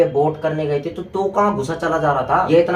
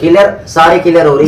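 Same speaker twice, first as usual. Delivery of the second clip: loud argumentative comeback; heated retort; 9 dB louder than usual. Real, not acted. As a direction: answer back with raised voice. (ऊँची आवाज़ में जवाब)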